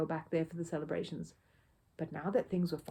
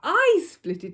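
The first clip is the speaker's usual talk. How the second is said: much higher, much louder outraged cry; angry cry; 10 semitones higher, 13 dB louder, slower than usual. A narrator's reading. Neighbour furious about his split jacket, crying out. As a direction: shriek it back at him in fury. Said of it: "he cried".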